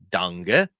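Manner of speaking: "Danke" said as DUNG-uh, ending in uh; regional pronunciation. Shown as a demonstration. Teacher shows another regional thank you, "Danke" as DUNG-uh. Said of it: "also hear some people say".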